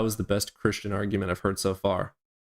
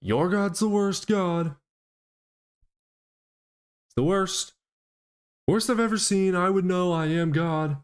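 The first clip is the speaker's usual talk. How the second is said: much higher, louder mock Trump boast; Trump impression; 10 semitones higher, 3 dB louder than usual. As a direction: ham up a cartoonish Trump bragging. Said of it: "I can't do a Trump impression".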